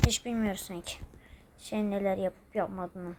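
hushed, unvoiced aside; secretive whisper to himself. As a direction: whisper it so they can't hear. Character mutters secret aside.

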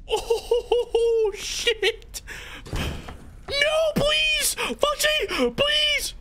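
real high-pitched panic scream; panicked pleading. Real, not acted. Player begging Foxy in a game, screaming in panic. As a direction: shriek it out, begging for mercy.

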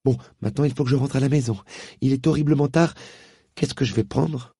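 put-on girl anxious to get home; young girl Polly. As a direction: rush it like a girl late home. Polly voiced by narrator.

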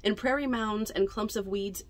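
ordinary delivery, nothing marked

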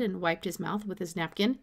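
ordinary delivery, nothing marked

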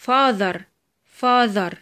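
"father" with buzzing th mid-word; voiced TH. Shown as a demonstration. Teacher gives examples of ذ, "father" with voiced th. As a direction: model "father" twice, falling, voiced th. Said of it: "ونطقتها ذا".

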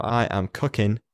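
ordinary talk, nothing marked

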